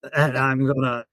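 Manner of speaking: slow, stretched Australian impression; Steve Irwin. Shown as a demonstration. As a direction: put on a drawling Aussie accent. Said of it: "is Steve Irwin".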